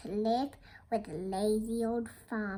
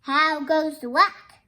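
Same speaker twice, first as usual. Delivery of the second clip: loud farmer's call; projected storybook holler; 3 semitones higher, 9 dB louder than usual. Acted. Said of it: "expecting to hear".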